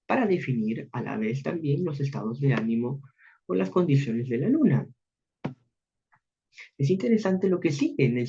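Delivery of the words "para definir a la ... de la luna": usual speaking voice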